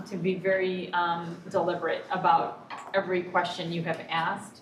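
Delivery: ordinary talk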